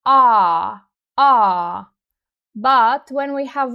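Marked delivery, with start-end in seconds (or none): 0.1-1.8 "a" held long as a loud "aaa"; low-toned, sustained "aah"; long vowel. Shown as a demonstration. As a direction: stretch out the "a". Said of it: "long vowel sound".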